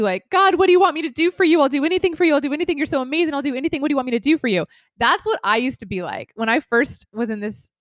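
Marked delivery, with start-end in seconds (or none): 0.3-4.7 eager pleading. Retold or imitated. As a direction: beg to be given a task, anxious to please. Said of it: "some of us may immediately go to".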